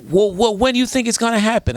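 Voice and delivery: imitated caller, high-pitched challenging question; caller with attitude. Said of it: "she calls up with attitude".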